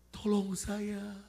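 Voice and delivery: slow, high wailing cry for help; imitated tearful plea. Girl crying for help from the back, slow high wail. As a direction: draw it out as a pitiful wail. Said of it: "tiba-tiba ada tangisan dari belakang".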